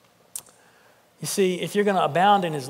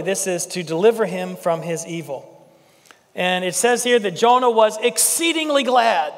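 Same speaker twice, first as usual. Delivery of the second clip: ordinary delivery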